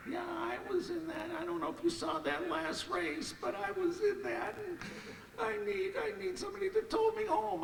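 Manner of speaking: put-on high, girlish plea for a tow; young girl's voice. Girl at the pay window asking for a tow, high girlish voice. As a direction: voice the girl way up high and quick at the end.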